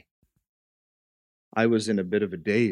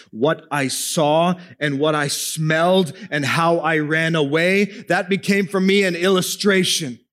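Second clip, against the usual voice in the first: high, loud, fervent sermon climax; preaching at full force; 12 semitones higher, 8 dB louder than usual. Acted. Preacher to congregation about Jesus coming to the mess, loud and fervent. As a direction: drive it home like a revival preacher.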